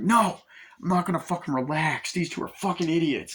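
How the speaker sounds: high-pitched mock outburst; angry refusal. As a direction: snap back as the worked-up driver. Acting out refusal to relax.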